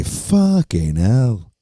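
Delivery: slow, drawn-out British "Fucking hell" with the vowel rounded off; mock British curse, stretched slowly; light British impression, slow; British accent. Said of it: "Or as the British say".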